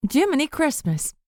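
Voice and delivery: high startled yelp of surprise; a sudden high yelp, caught off guard. A narrator's reading. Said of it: "May yelped".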